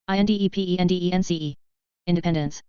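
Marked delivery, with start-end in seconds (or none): 0.1-1.6 quick letter run; brisk recap spelling; fast spelling. Shown as a demonstration. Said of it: "spelling drill, slow then fast".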